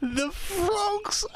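high tearful whimper; tearful cry. Acted. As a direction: whimper it near tears. Tearful repeat.

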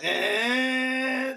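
high straining engine whine; imitated engine strain. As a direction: mimic the van struggling, high and thin. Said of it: "you hear it like".

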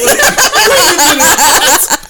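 high, loud and stretched-out disbelief; shocked. Retold or imitated. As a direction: shriek it, slow and stunned. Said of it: "And you're like".

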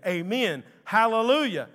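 rising to a loud, high hallelujah; worshipful acclamation. Acted. Elders worshiping at the throne, loud acclamation. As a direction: acclaim it, stronger on the second word.

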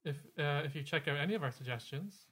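ordinary delivery

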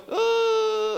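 long high-pitched "ooh"; wincing "ooh". Acted. Pastor voicing listeners hearing hard teaching, wincing. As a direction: draw out a high, uneasy ooh.

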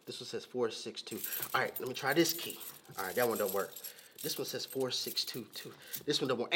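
low, quiet mutter trying each key; muttering to himself. Retold or imitated. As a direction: grumble under your breath at the lock. Muttering while trying keys.

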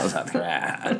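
low creaky vocal fry, laughing. Retold or imitated. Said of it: "Vocal fry".

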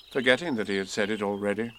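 ordinary delivery

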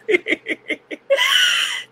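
bright shouted laugh of delight; high joyful laugh. Real, not acted. Joyful live stream opening.

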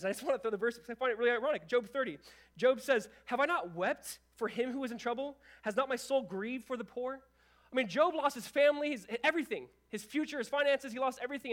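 loud, high, urgent preaching; fervent preaching. Acted. Pastor to the church, preaching Job's grief loudly. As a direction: lift the room with it.

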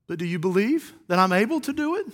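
soft question, rising at the end; simple question. Acted. Jesus asking if they believe, simple quiet question. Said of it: "I believe it was a simple question".

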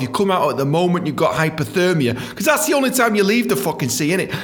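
usual speaking voice

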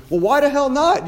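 high, loud and incredulous; a coach scolding students who won't practise; exasperated outburst. Real, not acted. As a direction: throw the excuse right back at them. Challenging students' excuses.

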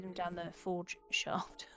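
whispering. Real, not acted. Joking aside under the DM.

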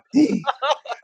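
high, laughing gasp; sharp high intake of breath; shocked gasp. Real, not acted. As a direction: gasp in amused disbelief. Shocked by the farting talk.

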